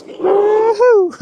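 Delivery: high, happy woo-hoo cheer; excited whoop. Real, not acted. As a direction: whoop with delight. Cheering on the first ride.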